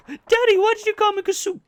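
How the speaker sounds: put-on high, loud whiny kid; child's voice. Imagined child complains about its name, high whine. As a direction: whine like a little kid to daddy. Acting his future child.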